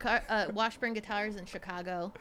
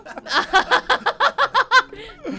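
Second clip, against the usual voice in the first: loud high laugh; 13 semitones higher, 11 dB louder than usual. Real, not acted. Big laugh at own joke.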